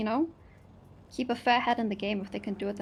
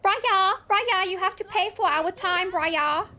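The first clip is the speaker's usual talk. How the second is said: calling out; 8 semitones higher, 6 dB louder, slower than usual. Acted. Calling out to Raya below.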